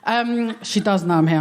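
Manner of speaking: ordinary delivery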